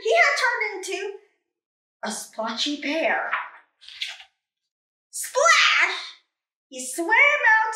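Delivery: ordinary delivery, nothing marked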